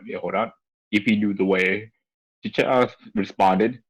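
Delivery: ordinary talk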